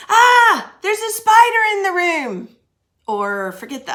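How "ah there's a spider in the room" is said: high, loud cry of fright at a spider; sudden scared shout, much higher and louder; alarmed exclamation. Shown as a demonstration. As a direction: shriek it like you just spotted a spider. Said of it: "What about".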